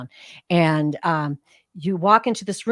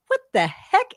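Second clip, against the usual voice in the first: surprised; 12 semitones higher, 4 dB louder than usual. Retold or imitated. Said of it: "and I'm like".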